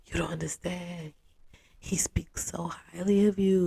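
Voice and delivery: whispered conspiratorial plea; boyfriend's friends leaning in to persuade her, whispered; confiding whisper. Retold or imitated. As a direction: whisper it like sharing a secret.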